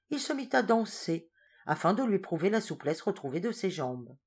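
usual speaking voice

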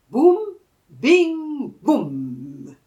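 loud sound-effect boum bing boum; boom bing sound. A narrator's reading. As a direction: call out the magic whoosh. Magic flight sound.

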